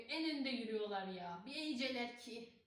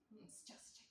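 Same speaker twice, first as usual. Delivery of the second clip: ordinary delivery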